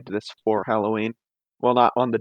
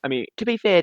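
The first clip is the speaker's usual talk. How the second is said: normal talking voice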